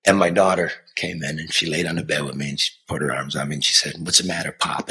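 tender. Real, not acted. A father remembering his daughter comforting him. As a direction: hush it down tenderly.

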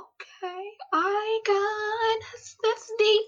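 very high, drawn-out squeak of surrender; tearful acted reply. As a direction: imitate an overwhelmed, teary acceptance. Acts out tearful reply to God.

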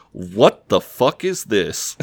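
slow, drawn-out disbelief; mock bafflement. Daisy finds a wig in his pants, mock bafflement. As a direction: stretch each word in playful disbelief. Retold shock at finding the wig.

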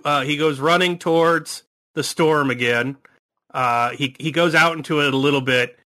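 normal talking voice

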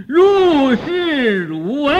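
high, loud and slow chant. Shown as a demonstration. Monk showing students how to wake a sleepy audience, high, loud, slow. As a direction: lift the voice suddenly to jolt the drowsy listener. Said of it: "再把这个声音提高了".

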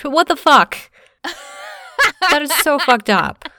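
loud, high outraged burst. Real, not acted. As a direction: blurt it out in disbelief. Outraged at "girl talk" dismissal.

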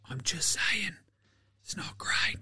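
soft whispered aside. Real, not acted. Muttered aside after mocking Philly, whispered. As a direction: whisper it under your breath.

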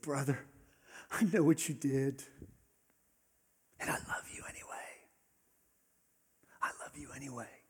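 soft, hushed forgiveness; tender whisper. Acted. Jesus whispering forgiveness to Judas, tender hush. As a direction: whisper it gently to a broken friend.

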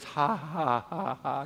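spoken ha-ha, imitating amused disbelief; mock laughter. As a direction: act out a deliberate, half-hearted laugh. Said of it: "It means laughter. They were just".

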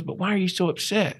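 high, quick puzzled question; Doug. Retold or imitated. Retold mentor repeats his question.

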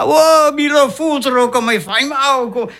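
high, pained howling; yelling in pain. Acted. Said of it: "à crier, à gueuler".

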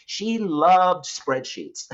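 amused, drawn out with rising pitch; slow line breaking into a laugh. Real, not acted. Speaker describing an accountant who loves the spreadsheets he hates, laughing. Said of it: "I say that laughing".

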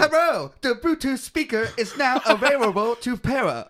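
put-on high, loud Thai-accented robot announcement. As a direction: mimic a Thai-accented speaker prompt, laughing. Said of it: "robot voice at the beginning has a very thick Thailand accent".

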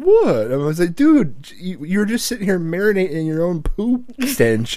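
high, loud mock disbelief; retold appalled outburst. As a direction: reenact scolding a kid in horrified amazement. Retold scolding of son in bathroom.

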